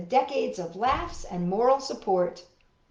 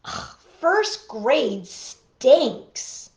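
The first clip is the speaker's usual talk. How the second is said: slow, drawn-out groan; disgusted groan; 3 semitones higher, 6 dB louder, much slower than usual. Acted. Child groaning about her new class. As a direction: drag each word in disgust.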